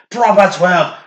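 loud, high Irish brogue; showy mock-Irish catchphrase; Irish accent. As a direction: belt it out in a put-on Irish accent. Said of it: "I instantly become, like, Irish".

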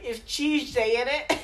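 high mock British, "Chewsday"; cheeky British impression with a laugh; mock British accent. Mimicking British people online, high mock British accent. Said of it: "British people would be like".

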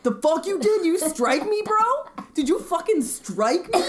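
hammed-up outrage, brawl ready; mock outraged. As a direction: pick a fight, indignant and loud. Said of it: "I'm like".

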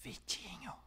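breathy hushed whisper of the name. Shown as a demonstration. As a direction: lean in and whisper it. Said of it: "Vou falar baixinho esse".

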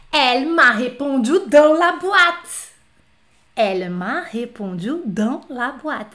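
slow, deliberate elle m'a répondu dans la boîte; slow word-by-word demo. Shown as a demonstration. Teacher showing students a literal translation, slow and deliberate. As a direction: spell out the literal translation slowly. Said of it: "Na lata".

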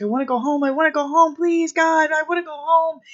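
put-on a scared teenager begging to be taken home; mock desperate pleading. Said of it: "he's yelling at the Scarab".

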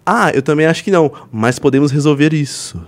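insinuação encenada, esticando o final; entonação insinuante. Shown as a demonstration. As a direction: leia a mensagem como flerte, desacelerando no fim. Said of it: "minha entonação foi essa". (entonação de quem dá em cima)

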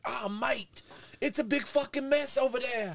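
high, hammed-up British exclamation; mock British accent. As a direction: exclaim it as an over-the-top Brit. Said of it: "Or was he like".

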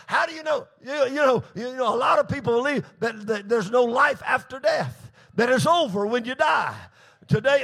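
emphatic, driving sermon delivery; full-force preaching. Acted. Preacher challenging the congregation's faith, forceful preaching. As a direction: preach it hard, building in pitch and force.

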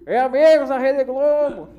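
drawn-out mock broadcast greeting; TV announcer parody. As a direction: hail the viewers like a TV commentator. Sports announcer catchphrase parody.

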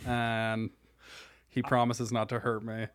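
ordinary talk, nothing marked

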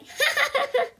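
high-pitched acted child's laugh; gleeful little brother cackling; quick peal of high giggles; delighted giggle. A narrator's reading.

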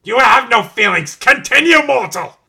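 high, loud villain command; angry command. Acted. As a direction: bellow at the mortal. Villain barks at Doctor.

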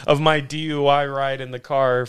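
ordinary talk

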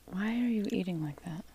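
hushed, breathy scolding whisper. Real, not acted. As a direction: whisper it so the mic won't catch it. Whispering to son during recording.

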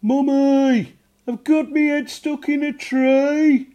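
high, loud, slow goblin cry for help; goblin shouting for mum. A narrator's reading. Little goblin calling his mum, high loud shout. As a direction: yell for mummy with your head stuck. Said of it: "he shouted".

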